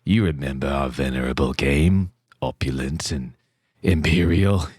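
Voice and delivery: low haunting narrator voice; spooky voice. Acted. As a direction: plead ominously like a doomed ancestor. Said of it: "Do it with a voice".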